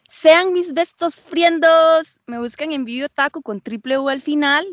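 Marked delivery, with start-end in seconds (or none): 0.1-2.2 slow, loud, sing-song streamer goodbye; cute anime streamer. Pretend. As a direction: stretch it out like a bubbly VTuber. Playful sign-off as anime streamer.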